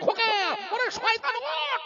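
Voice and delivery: very high, slow drawn-out call; desperate calling. Retold or imitated. Father Nuh calling his drowning son, drawn out. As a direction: cry out to a sinking child.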